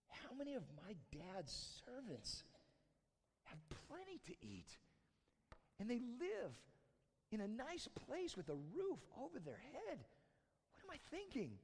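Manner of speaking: high, hushed wondering as the son; dawning realization. Acted. Prodigal son comes to his senses among the pigs, dawning realization.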